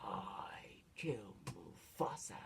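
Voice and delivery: slow, sinister stage whisper; whispering Scar. Retold or imitated. Imitating Scar's secret confession to Simba, whispering. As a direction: whisper it as a villain's confession, drawn out. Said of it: "And he goes".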